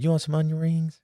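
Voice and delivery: wife interrupting with a snack offer, mock-sweet high voice. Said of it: "she came in and she said".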